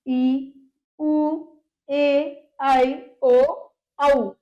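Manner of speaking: ई, उ, ए, आई, ओ, औ each held long and separate; long vowels. Shown as a demonstration. Teacher listing vowels that take time, drawn-out vowels. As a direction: recite ई, उ, ए, आई, ओ, औ as stretched single sounds. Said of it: "मात्रा time लगा ना".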